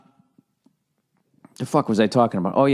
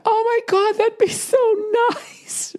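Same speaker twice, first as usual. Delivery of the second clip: very high falsetto mock-female squeal; gushing woman; slower than usual. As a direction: gush in falsetto, fading to airy on the last word. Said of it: "Women love that".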